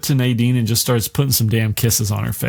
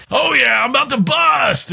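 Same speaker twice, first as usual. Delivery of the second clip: hammed-up demon yell; 13 semitones higher than usual. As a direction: ham up the gross villain. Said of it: "And he's just like".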